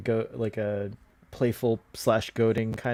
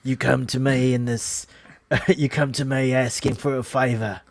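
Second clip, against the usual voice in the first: Godfather impression. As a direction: mumble it like the Godfather. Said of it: "It would be more like".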